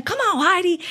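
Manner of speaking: put-on high, cheering Southern accent. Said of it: "her Southern accent".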